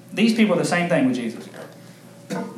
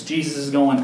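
normal talking voice

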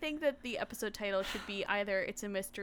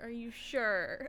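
very slow concerned question; slow therapist voice; much slower than usual. Pretend. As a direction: stretch it out like a therapist.

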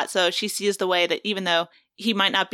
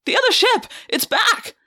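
high and louder; alarmed cry; 13 semitones higher, 4 dB louder than usual. Acted. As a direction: shout the warning in alarm. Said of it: "Rachel cried".

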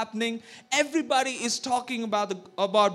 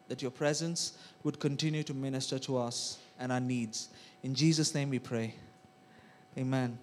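soft, lower prayer voice; 7 semitones lower, 7 dB quieter than usual. Acted. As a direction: pray quietly and reverently. Leads opening prayer.

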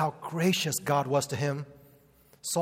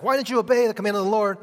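higher, louder, fast incredulous demand; incredulous Samuel; 6 semitones higher, 7 dB louder, much faster than usual. Retold or imitated. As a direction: fire the question at him in disbelief. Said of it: "He's like".

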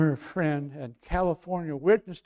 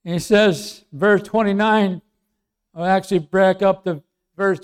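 ordinary talk, nothing marked

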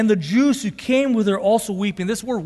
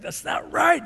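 a higher, indignant retort; indignant protest; 7 semitones higher than usual. Retold or imitated. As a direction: snap it out like a wronged friend. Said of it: "have you been so angry".

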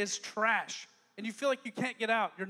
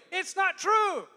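loud, higher shout; 6 semitones higher, 4 dB louder, much slower than usual. Acted. God declaring the lie false, shouting.

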